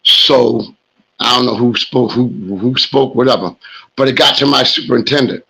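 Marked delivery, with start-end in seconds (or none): none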